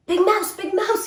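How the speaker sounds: high, loud call; little mouse calling about the rain. A narrator's reading. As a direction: call out in alarm. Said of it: "he called".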